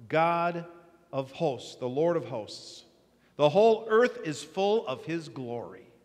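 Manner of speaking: measured, solemn Bible reading; scripture reading. Acted. Preacher reading Isaiah to congregation, solemn. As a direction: read the passage with weight.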